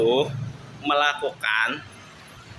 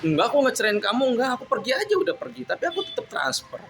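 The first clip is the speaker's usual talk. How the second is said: agitated; 8 semitones higher than usual. Real, not acted. Defending himself, high and agitated.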